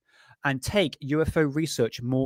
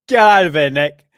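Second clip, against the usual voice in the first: mock shout; 2 semitones higher, 10 dB louder than usual. Shooing Nick Pope's image away.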